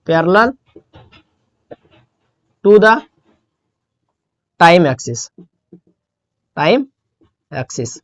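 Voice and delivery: isolated words with long pauses between; slow dictation. Shown as a demonstration. Teacher giving students the exam answer, in slow dictation. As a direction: dictate it piece by piece, as if writing it out.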